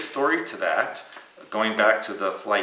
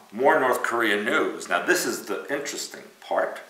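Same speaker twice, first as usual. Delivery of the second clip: ordinary talk, nothing marked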